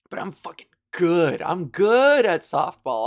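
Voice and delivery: bragging. Real, not acted. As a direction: puff up and brag. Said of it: "bragging about softball".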